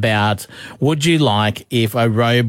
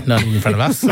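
ordinary delivery

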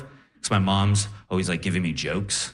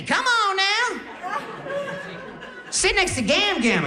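squeaky, very high comic swagger; Cat Williams. Retold or imitated. Grandma on morphine beckons grandson over, squeaky Def Jam-style imitation. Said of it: "she was talking in a crazy accent, like, uh, like Def Jam comedy, like Cat Williams".

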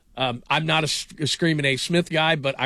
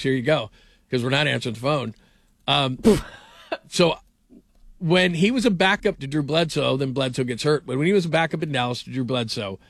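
usual speaking voice

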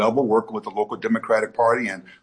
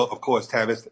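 ordinary talk

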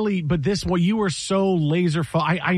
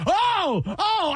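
imitated screaming; 14 semitones higher than usual. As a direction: wail two long high "oh"s like a frantic fan. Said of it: "And he's screaming".

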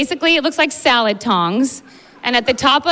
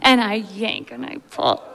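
usual speaking voice

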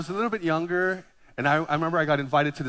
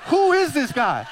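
higher and slow, a mock-alarmed rebuke; mock panicked rebuke; 7 semitones higher, slower than usual. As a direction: play up your inner panic at the stranger. The preacher's panicked inner thought.

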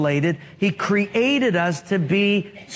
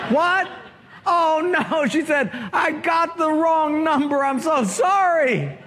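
high, flustered gasp of apology; shocked embarrassment; 7 semitones higher than usual. Acted. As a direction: caught out and mortified, squeaking sorry. Caller realizes wrong number.

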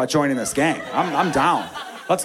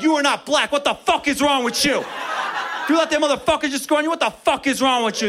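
angry razzing; 10 semitones higher than usual. Retold or imitated. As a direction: chew out the teammate who got scored on. Other team yells at defender.

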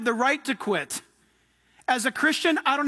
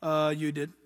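lower, softer, caught-out mumble; sheepish Moses; 9 semitones lower, 5 dB quieter than usual. Acted. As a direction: concede it like a scolded kid. Moses sheepishly answering God.

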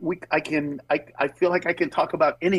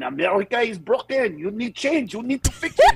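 put-on French accent; 4 semitones higher than usual. French guy complaining that America is broken, French accent. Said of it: "if it was some French guy going".

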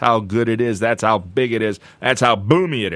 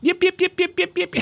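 much higher yappy little dog yips; yipping poodle. Retold or imitated. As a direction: yip like a frantic tiny poodle. Imitates poodle barking.

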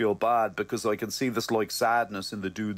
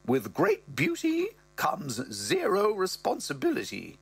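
higher put-on mother voice; mother's voice; 6 semitones higher than usual. Mother recalling angry fathers on the phone, higher voice. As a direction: tell it as the mum, higher. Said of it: "She goes".

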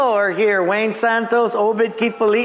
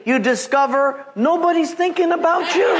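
higher, laughing through the punchline; chuckling delivery of the joke; 9 semitones higher than usual. Real, not acted. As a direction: let the laugh break into the words.

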